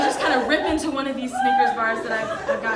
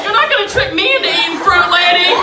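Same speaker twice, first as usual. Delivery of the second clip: loud, raised-pitch refusal; mock defiance; 4 semitones higher, 8 dB louder than usual. Audience member holding her Snickers at the performer, mock defiance. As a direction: shout them down in comic indignation.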